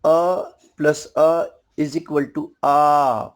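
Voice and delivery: slow, spelled out अ plus अ into आ; vowel sum. Shown as a demonstration. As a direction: build आ out of two short अ. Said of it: "यानी".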